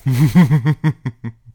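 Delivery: loud, falling villain laugh; host teasing listeners about the cliffhanger, evil laugh. Pretend. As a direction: boom out a mock villain laugh. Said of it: "This is my evil laugh".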